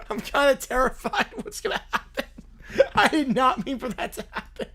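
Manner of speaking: talking through real laughter; helpless giggle breaking into the words; laughing. Real, not acted. Streamer dreading what happens next, laughing. Cracking up at what was generated.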